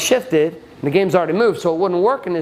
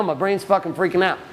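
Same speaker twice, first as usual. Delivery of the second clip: normal talking voice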